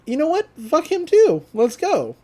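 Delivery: mock-exasperated, pitch jumping up; exasperated urging. [name] gives up on his friend, exasperated.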